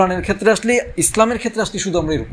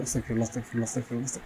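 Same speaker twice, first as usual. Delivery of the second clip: low, much softer rote mumble; mindless mumbled dhikr; 6 semitones lower, 13 dB quieter, much faster than usual. Shown as a demonstration. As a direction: rattle off আস্তাগফিরুল্লাহ under the breath, low and barely audible, like an empty habit. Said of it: "একটা Arabic ওয়ার্ডকে স্মরণ করতেছি". (নিচু স্বরে যান্ত্রিকভাবে বিড়বিড় করে জিকির)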